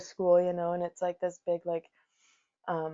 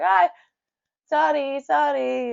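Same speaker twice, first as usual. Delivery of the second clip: higher, sheepish sorry; sheepish apology; 9 semitones higher, 7 dB louder, slower than usual. Retold or imitated. Driver apologizing sheepishly to passengers, higher and mock. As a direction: apologize with an embarrassed giggle. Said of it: "He's like".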